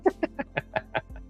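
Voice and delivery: airy giggle, high and light; high breathy laugh. Real, not acted. Laughing at the toilet brush joke.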